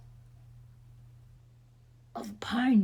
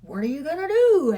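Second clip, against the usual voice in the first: put-on alarmed boy; 6 semitones higher, 10 dB louder, faster than usual. A narrator's reading. Fritz alarmed at the fairy.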